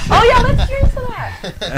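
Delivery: high laughing toast. Real, not acted. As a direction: laugh through the cheers.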